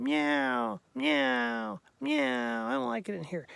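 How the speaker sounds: high, complaining meows trailing into words; mock cat wail, falling each time; unhappy cat. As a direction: imitate a cat protesting its box. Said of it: "Cat didn't like being in the box".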